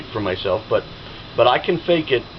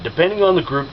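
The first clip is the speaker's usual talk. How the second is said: usual speaking voice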